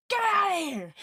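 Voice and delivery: sudden high playful yell; mock shout. Yelling at the lagging stream.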